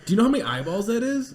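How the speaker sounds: high, fast, laughing disbelief. Real, not acted. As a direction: laugh through the question. Laughing disbelief at audience size.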